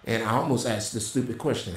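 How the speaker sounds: ordinary delivery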